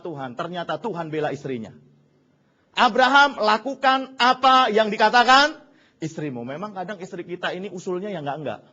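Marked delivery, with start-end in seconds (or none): 2.7-6.4 loud, high commanding call; mock divine command; booming voice of God. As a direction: thunder it down like God. God's command retold, booming.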